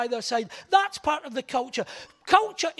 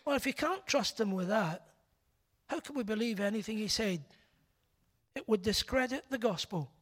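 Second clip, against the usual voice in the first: imitating doubters; 4 semitones lower, 8 dB quieter than usual. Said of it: "some of them would say".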